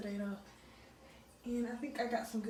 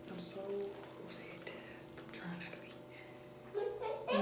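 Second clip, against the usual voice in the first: whisper; 6 dB quieter, much slower than usual. Real, not acted. Late night, upset, aside to camera.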